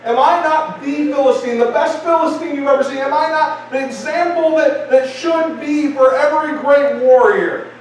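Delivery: high, swaggering rhetorical questions; proud warrior's taunt; boastful Goliath. Acted. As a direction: brag like the champion of the army. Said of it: "he begins to talk smack".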